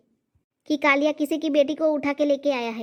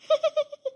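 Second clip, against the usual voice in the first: shy giggle; 12 semitones higher than usual. Acted. Son shy about new bride.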